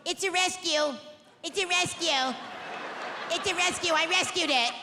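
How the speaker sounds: lightly put-on precious dog-owner voice; mocking dog owner. Mocking rich people walking their dogs on Rodeo Drive, precious put-on voice. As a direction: imitate a self-satisfied rich pet owner.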